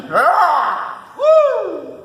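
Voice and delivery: loud, high whoop; hype yell. Real, not acted. Thor hyping up the gym with loud whoops. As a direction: whoop like a crowd.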